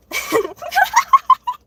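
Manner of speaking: loud high cackle breaking out; high burst of laughter. Real, not acted. Streamer laughing at her own mock voice. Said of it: "laugh after mock voice".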